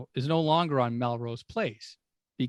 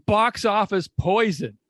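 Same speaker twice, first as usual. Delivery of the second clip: higher, louder, slow deadpan punchline; Norm's punchline; 7 semitones higher, 7 dB louder, slower than usual. Retold or imitated. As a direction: land the zinger slowly and loudly. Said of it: "Norm goes".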